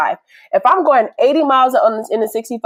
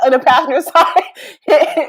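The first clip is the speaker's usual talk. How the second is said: ordinary delivery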